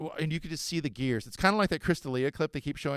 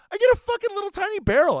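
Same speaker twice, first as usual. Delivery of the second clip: excited; 10 dB louder than usual. Real, not acted. Excited surf story.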